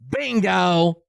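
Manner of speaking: high gloating Bingo; mock Phil Bingo. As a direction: crow it like a winner. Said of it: "Phil says".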